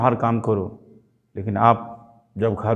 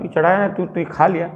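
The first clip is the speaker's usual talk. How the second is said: father scolding, higher; 6 semitones higher, faster than usual. Retold or imitated. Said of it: "मैं आपको बता रहा हूँ".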